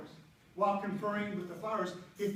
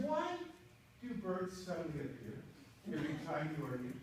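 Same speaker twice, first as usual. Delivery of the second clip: soft quote; 6 semitones lower, 8 dB quieter than usual. Retold or imitated. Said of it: "she said".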